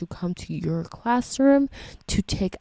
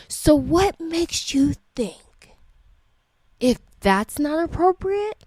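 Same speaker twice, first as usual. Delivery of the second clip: high, slow indignant questioning; 5 semitones higher than usual. Real, not acted. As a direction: demand an answer, stunned.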